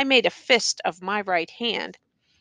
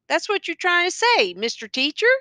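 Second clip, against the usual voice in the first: high-pitched, pointed challenge; taunting question; 9 semitones higher than usual. A narrator's reading. Prisoner presses his silent teacher, taunting and higher. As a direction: press him with a needling question.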